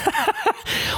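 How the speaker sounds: short, high-pitched laugh; real high giggle of amusement. Real, not acted. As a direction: break into a high, light laugh. Laughing at his friend's story.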